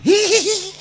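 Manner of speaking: high, squeaky ticklish giggle; high-pitched girlish titter. Retold or imitated. Bride giggles when hand touched.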